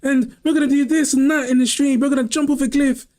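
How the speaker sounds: high, laughing YouTuber intro voice. Retold or imitated. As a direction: hype it up like a 2015 YouTuber. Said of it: "Hey guys, and welcome to the channel".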